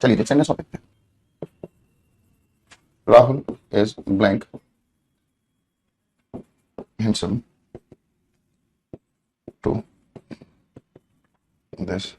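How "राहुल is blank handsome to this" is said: low, soft, word by word; long pauses as each word gets written; slow dictation. Real, not acted. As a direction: say it slowly to yourself while writing. Dictating while writing on board.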